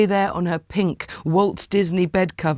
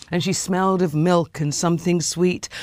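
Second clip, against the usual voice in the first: ordinary delivery, nothing marked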